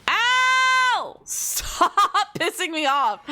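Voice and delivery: high, slow yell through laughter; half-angry laughing yell; laughing shout. Real, not acted. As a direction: holler while cracking up. Hit, laughing while yelling.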